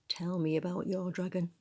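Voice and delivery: plain reading voice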